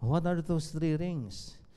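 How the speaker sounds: slow, soft, puzzled question; puzzled groom. Retold or imitated. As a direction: ask it like a bewildered groom. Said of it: "groom's question". (tanong ng nalilitong lalaking ikakasal, mabagal at mahina)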